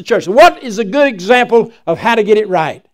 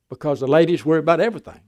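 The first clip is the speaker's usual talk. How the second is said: ordinary talk, nothing marked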